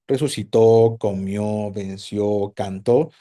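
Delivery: low-pitched list, each of "Resucitó, comió, venció, cantó" stressed on its last O; "Resucitó, comió, venció, cantó" recited low, accent on each ending; final-syllable stress. Shown as a demonstration. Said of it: "la sílaba final".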